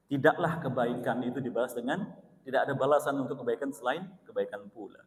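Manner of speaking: ordinary delivery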